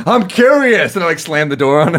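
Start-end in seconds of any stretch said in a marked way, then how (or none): 0.1-0.9 much higher, cheeky whiny reply; mock defiant kid. Son answering his angry dad, cheeky defiance. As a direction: toss it back like a sassy twelve-year-old.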